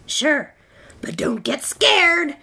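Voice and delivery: loud, high, raspy monster; slow, swelling monster warning. A narrator's reading. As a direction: tease the kid in a big monster rasp. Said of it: "a third voice from under the bed rasped".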